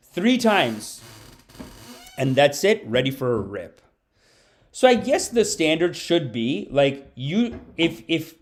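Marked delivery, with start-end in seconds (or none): none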